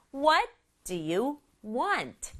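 slow beat, "What" and "want" stressed; measured wave rhythm landing on "What" and "want". Shown as a demonstration. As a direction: punch "What" and "want", with "do you" between. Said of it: "원하니가 중요해요".

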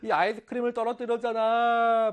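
high-pitched pouty complaint; upset, aggrieved whine. Acted. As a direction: whine like a wronged child. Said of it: "너무 안타깝고 억울한 겁니다".

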